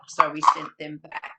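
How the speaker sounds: lively read-aloud for little ones; reading to children. Acted. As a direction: tell it like storytime with small kids. Reading Dear Zoo to children.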